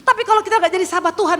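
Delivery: high, loud call to applaud God; rousing preaching. Real, not acted. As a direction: lift the congregation up with a shout. Preacher celebrates healing. (seruan khotbah yang lantang dan tinggi)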